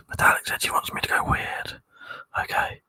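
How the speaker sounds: hushed ASMR whisper for the stream chat. Pretend. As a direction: whisper like you're lulling viewers to sleep. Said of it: "Would you like some A S M R?".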